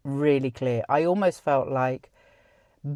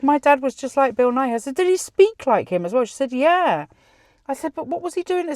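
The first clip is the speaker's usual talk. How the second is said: excited exchange; 8 semitones higher, 4 dB louder than usual. Retold or imitated. Excited back-and-forth retold.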